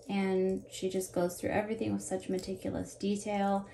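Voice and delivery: usual speaking voice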